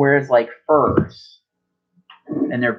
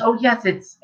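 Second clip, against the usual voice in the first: high eager agreement; 9 semitones higher than usual. Acted. Grandmother voiced again.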